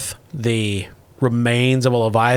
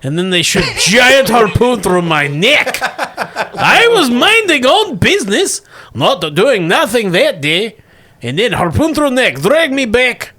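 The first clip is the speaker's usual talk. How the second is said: higher, aggrieved broken-English creature; whiny monster voice; 6 semitones higher than usual. Acted. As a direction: complain like a wronged stoner sea beast. Leviathan complains in broken English.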